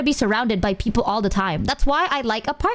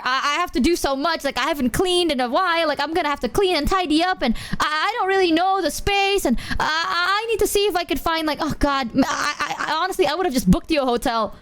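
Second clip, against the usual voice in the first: higher, hurried, stammering excuses; imitated flustered rambling; 5 semitones higher than usual. Imitating friend unprepared for a guest, flustered and stammering. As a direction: ramble anxiously as if mimicking a panicked host.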